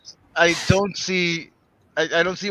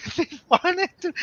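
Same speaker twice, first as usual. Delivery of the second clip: laughing. Real, not acted. Joking about friend in dress.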